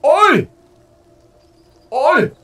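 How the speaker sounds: loud, high cockney-style British imitation; hammed-up British accent, calling out; mock British accent. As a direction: shout it like a rowdy Brit at the station. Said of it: "I didn't sense any of that posh English".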